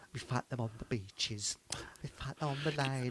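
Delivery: a hint of Churchill rumbling the speech low; mild low Churchill mimicry; Winston Churchill. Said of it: "Winston Churchill".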